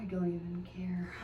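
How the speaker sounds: muttered under breath to herself; whisper. Real, not acted. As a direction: whisper it like a private aside. Whispered aside to self.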